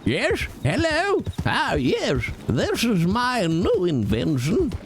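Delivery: high, posh British eccentric voice; a dotty British inventor. Pretend. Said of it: "I should make him British".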